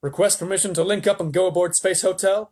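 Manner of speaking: lightly voiced astronaut reporting in; astronaut on radio. A narrator's reading.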